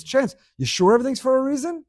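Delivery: ordinary talk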